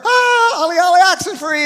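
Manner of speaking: very high, loud, fast shouted game call; cheering shout. Retold or imitated. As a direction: yell it like the crowd adores him. Crowd cheering Jesus, game call.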